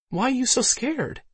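high-pitched puzzled woman's voice; puzzled Alice. A narrator's reading. Alice to her frightened master, puzzled. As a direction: lift it right up, asking in confusion.

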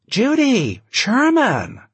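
high, loud, delighted hostess call; excited greeting. Acted. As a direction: call out their names with delight. Hostess greeting guests loudly.

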